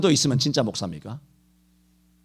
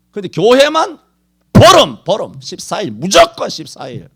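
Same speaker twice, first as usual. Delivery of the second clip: loud, high outburst; raised preacher's cry of protest; indignant shout; 4 semitones higher, 10 dB louder than usual. Acted. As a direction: hammer out the complaint. Pastor railing at church closures.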